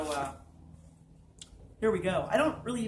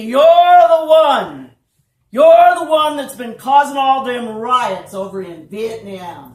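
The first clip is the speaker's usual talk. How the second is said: full-volume drawn-out, higher-pitched yell; screaming accusation; 4 semitones higher, 19 dB louder, slower than usual. Acted. Druggist accuses the narrator of causing riots, screaming. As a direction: scream it out, stretching the words. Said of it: "He starts screaming at me".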